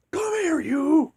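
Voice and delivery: high, loud hammed-up monster snarl; monster grabbing kid. Monster Mister Vile yelling at the kid, high and loud. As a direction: lunge at the kid with a mock monster voice. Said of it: "Mister Vile's like".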